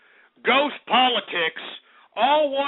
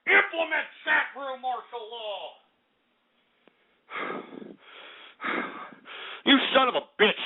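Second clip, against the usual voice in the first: ordinary delivery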